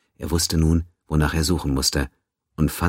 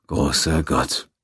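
put-on very old man; much slower than usual. A narrator's reading. Old doctor shocked by death news.

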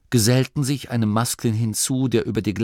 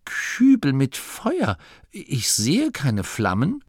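slow, high-pitched disbelieving question; ungläubig; 6 semitones higher, slower than usual. Acted. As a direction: repeat it incredulously, drawing it out. Masklin zweifelt an den Feuerkübeln. (ungläubig gedehnt, hohe Stimme)

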